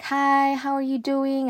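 high, sing-out polite greeting; consulate lady. Retold or imitated. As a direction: imitate a sweet receptionist's hello. Imitates the consulate lady's greeting.

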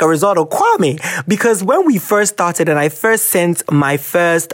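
ordinary talk, nothing marked

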